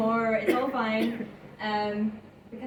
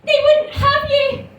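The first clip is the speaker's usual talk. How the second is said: much higher, louder, slow snapping retort; scornful granny; 10 dB louder, slower than usual. Retold or imitated. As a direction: put the old man down with a high shout. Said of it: "my granny would be like".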